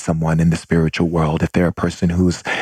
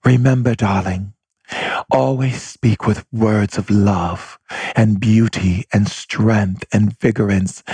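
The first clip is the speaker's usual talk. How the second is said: slow, measured, tender motherly counsel; imitating an ethereal mother, drawn-out words; soft-spoken mother; 3 semitones higher than usual. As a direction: speak like a fairy godmother giving a gentle blessing. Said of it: "This is how my mother speaks".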